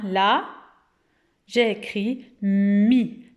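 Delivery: usual speaking voice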